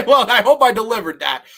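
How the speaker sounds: words spill out through a real laugh; chuckling at his own punchline. Real, not acted. Pleased with his own joke, laughing.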